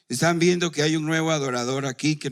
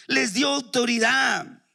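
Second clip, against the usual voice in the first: shouted proclamation; 7 semitones higher, slower than usual. Shown as a demonstration. Preacher repeating to the church, now shouting "dio". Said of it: "Les dio autoridad el Señor".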